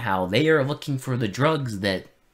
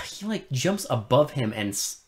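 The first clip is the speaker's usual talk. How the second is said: ordinary talk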